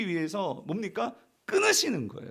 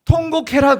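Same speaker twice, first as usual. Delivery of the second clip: loud, higher cry to wail; proclaiming; 6 semitones higher, 13 dB louder than usual. Acted. Preacher shouting God's order to the people to wail aloud. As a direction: call out the order with force.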